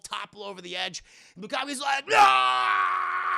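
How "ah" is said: long, high, loud cartoon scream; falling scream. Villain toppling over the edge, long loud scream. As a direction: scream all the way down.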